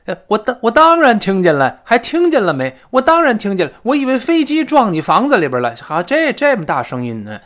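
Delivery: loud, high-pitched exasperated retort; worked up, impatient. Acted. First person answering whether they heard the crash, worked up. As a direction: fire back loudly, indignant at being asked. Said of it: "第一个人急了".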